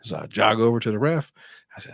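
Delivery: ordinary delivery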